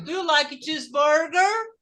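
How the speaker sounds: "Do you like cheeseburger?" rising to the last syllable; American question intonation. Shown as a demonstration. Teacher has pupils repeat the question, rising tune.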